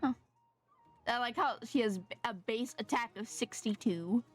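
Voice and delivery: usual speaking voice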